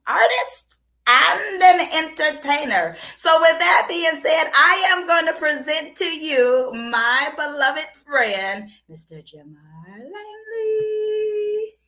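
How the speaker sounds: loud, slow announcer build to the name; emcee introduction. Real, not acted. Host introducing her guest, loud slow announcer voice. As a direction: present him like a star.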